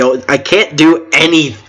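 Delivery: normal talking voice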